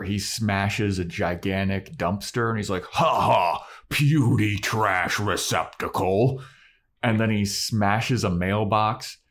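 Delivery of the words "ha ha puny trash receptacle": slow, booming troll voice. Retold or imitated. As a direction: gloat like a dumb brute. Troll voiced, retelling scene.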